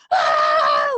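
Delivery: high, loud scream of joy. Retold or imitated. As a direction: let out a shriek of happy disbelief. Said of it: "screamed so loud".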